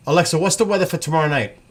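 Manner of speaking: loud and quick to the speaker across the room; calling out. Real, not acted. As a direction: raise your voice so the device hears.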